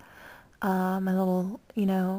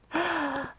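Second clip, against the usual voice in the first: sharp, high gasp; delighted gasp; 7 semitones higher than usual. Real, not acted. A fan gasping at a compliment, surprised. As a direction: gasp in disbelief. Reaction to being praised.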